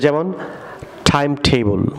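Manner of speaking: normal talking voice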